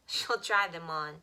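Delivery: ordinary delivery, nothing marked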